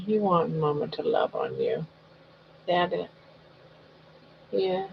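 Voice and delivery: soft doting pet talk; talking to a dog. Real, not acted.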